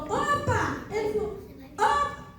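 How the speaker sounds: high, soft excited exclamation; excited opa. Retold or imitated. Friends greeting the girls at carnival, high and soft. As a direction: toss off a bright opa.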